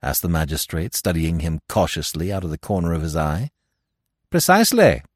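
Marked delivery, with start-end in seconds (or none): 4.3-5.0 high, loud, crisp confirmation; assured Giraud. A narrator's reading. Giraud answering the doubting magistrate, assured. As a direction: confirm it emphatically, pitch well up.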